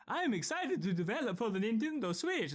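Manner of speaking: high, exaggerated Japanese accent; Suda51 Japanese accent. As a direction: put on a rough Japanese accent. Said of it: "as close as I can do to a Japanese accent".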